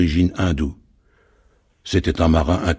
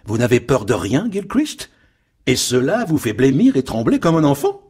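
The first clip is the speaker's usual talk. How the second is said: mocking, pitched up high; ironic and scornful; 8 semitones higher than usual. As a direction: sneer at his fear. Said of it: "s'éleva alors ironique et méprisante".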